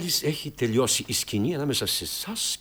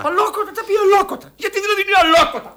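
fast, high-pitched indignant questions; offended outburst; 4 dB louder, faster than usual. Acted. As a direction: throw the word back, rattled and shrieky-high. Director takes offence at the remark.

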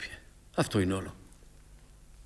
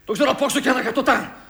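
high, heated insistence; angry outburst; 11 semitones higher, 4 dB louder than usual. Acted. Son telling his father he knows this world, angry outburst. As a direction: hurl it back in a raised pitch.

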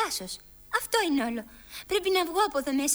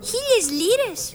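astonished; 5 semitones higher, 4 dB louder, slower than usual. Acted. Alice astonished at the price.

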